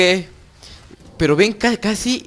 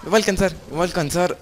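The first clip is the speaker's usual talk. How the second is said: 1 panicked; 6 semitones higher, 5 dB louder, faster than usual. Real, not acted. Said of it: "estoy nervioso".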